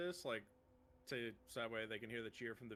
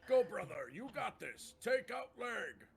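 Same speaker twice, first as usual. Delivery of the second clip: orc cheer; 9 semitones higher, 6 dB louder than usual. Acted.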